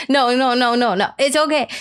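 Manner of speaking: ordinary talk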